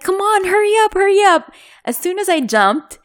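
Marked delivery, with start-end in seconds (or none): 0.0-1.5 high, pushy call to hurry; urgent hurry-up call. Retold or imitated. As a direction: urge her over the wall, loud and high. Friend urging from over wall.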